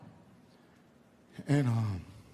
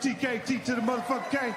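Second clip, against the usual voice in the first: name hyped up with a higher pitch; hype shout; 5 semitones higher than usual. Real, not acted. Comic hyping up the name T K Kirkland, higher pitched.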